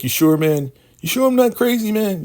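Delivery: loud, shrieky high pitch; mock crazed leader. Shogun off his meds doubting River, mock crazed. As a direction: push it up into a loud, unhinged squeal. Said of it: "he's not on his meds".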